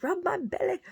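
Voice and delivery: high-pitched pleading dog voice; dog's voice. Retold or imitated. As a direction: squeal it as the dog begging for attention. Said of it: "she's like".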